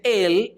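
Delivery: Él pitched high and stressed; Él said high, like an exclamation; emphatic Él. Shown as a demonstration. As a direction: spike the word Él. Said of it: "es un énfasis".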